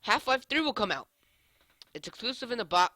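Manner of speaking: hyped announcement. Acted. Loud mock announcement.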